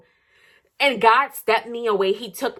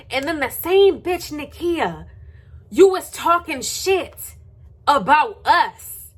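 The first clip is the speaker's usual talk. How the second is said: high, slow taunting voice aimed at Nakia; high, slow mock at the gossip; mocking call-out; 5 semitones higher, 3 dB louder, slower than usual. Real, not acted. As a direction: drag it out to rub it in.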